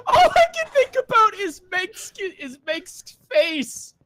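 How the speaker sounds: high, loud, laughing through the words; real laughing glee. Real, not acted. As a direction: crack up while getting it out. Cracking up at imagined reaction.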